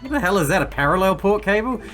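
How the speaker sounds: ordinary talk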